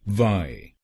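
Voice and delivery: वाई said alone as a clear long "vaai"; syllable demo. Shown as a demonstration. Teacher repeating the first syllable, careful pronunciation. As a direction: isolate वाई, drawn out like "vaai". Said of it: "को बोलिए वाई".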